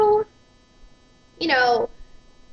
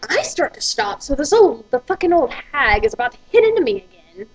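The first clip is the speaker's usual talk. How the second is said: ordinary delivery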